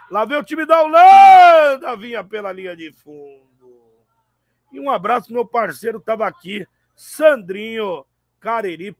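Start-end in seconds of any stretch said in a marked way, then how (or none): 0.1-4.0 loud sudden call of the attack; excited play-by-play. Real, not acted. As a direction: snap back to the action loudly. (narração empolgada e alta)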